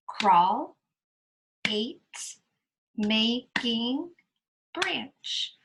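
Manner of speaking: normal talking voice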